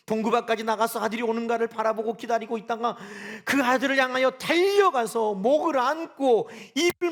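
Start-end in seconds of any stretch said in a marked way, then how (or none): 3.4-7.1 slow, louder and higher; fervent sermon build; impassioned preaching. Acted. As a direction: pour emotion into the embrace. Preaching peak, slow and loud.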